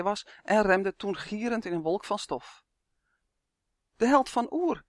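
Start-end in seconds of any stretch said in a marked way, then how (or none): 4.0-4.8 high, excited cry of recognition; understated shriek, pitched well up; mild yell. A narrator's reading. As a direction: cry it out high without full volume. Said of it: "gilde een bekende stem".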